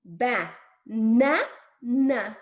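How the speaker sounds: BA... NA... NA, the NAs loud and stretched; exaggerated syllables. Shown as a demonstration. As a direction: give BA, NA, NA one beat each with pauses. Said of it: "one tile for each sound".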